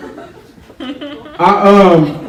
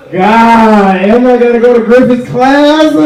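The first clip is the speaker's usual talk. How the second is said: higher, louder groan of dread; 7 semitones higher, 3 dB louder, slower than usual. Retold or imitated. Student dreading Griffith's class, mock whine. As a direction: whine about going to class. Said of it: "You wake up every morning like".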